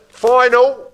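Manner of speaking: high, loud auction call; calling final. Real, not acted. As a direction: call it out across the room.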